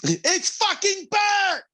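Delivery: very high, slow shouted mock chef rage; Gordon Ramsay rant. Mock celebrity chef yelling about burnt breakfast. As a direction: scream it like a furious TV chef. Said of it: "go full Gordon Ramsay".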